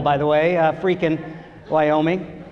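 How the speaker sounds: ordinary talk, nothing marked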